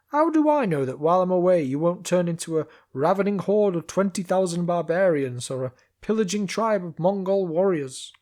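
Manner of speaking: loud guard voice, doubtful; suspicious guard calling. Acted. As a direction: call down, unconvinced. Guard voicing suspicion down the wall.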